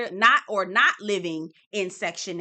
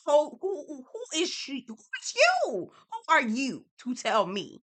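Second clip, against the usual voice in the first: much higher, slowing on who is you; incredulous scoff; 5 semitones higher than usual. Retold or imitated. Mocking the woman who lectured her, incredulous and high. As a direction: pitch it up in mock outrage at the nerve.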